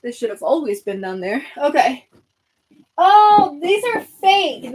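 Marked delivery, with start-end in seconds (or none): 3.0-4.7 surprised discovery. Real, not acted. Discovering fake decorations.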